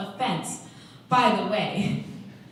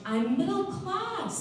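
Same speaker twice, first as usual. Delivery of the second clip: high, drawn-out indignant objection; indignant protest; 10 semitones higher, slower than usual. Acted. Newcomer correcting a rich-girl taunt, indignant. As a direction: stretch it out slowly, pitched way up.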